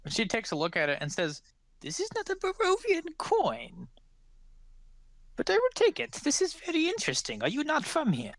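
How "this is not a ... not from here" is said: put-on intrigued old lady turning the coin over; curious old woman. Old woman examines the foreign coin, very high curious voice.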